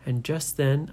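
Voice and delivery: usual speaking voice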